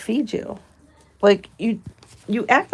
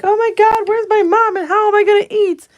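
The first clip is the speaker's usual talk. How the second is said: much higher and louder, shrill mock panic; panicking kids; 13 semitones higher, 9 dB louder than usual. Her baby animals waking to find mom gone, mock panic. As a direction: squeal it like frantic little animals. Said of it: "my kids would wake up and be like".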